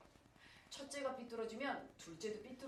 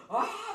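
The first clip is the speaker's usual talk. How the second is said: loud, high mock bawl; hammed-up crying wail; imitated wailing; 12 semitones higher, 11 dB louder than usual. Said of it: "막 이렇게 울었어".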